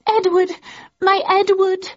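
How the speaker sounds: high-pitched pleading cry; anguished high woman's plea; imploring. A narrator's reading. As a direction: call out to a beloved, high and desperate. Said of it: "implored Jane".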